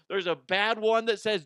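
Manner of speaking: normal talking voice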